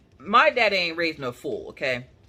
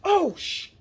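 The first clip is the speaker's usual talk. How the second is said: alarmed gasp; 13 semitones higher than usual. Retold or imitated. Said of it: "So I was like".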